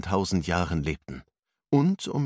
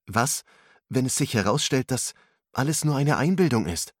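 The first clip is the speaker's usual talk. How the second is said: a higher, doubting voice for Ruslan; doubtful question; 6 semitones higher than usual. A narrator's reading. Ruslan asks his friend if it is real, doubtful. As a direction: let the doubt lift the pitch. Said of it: "fragte Russland zweifelnd".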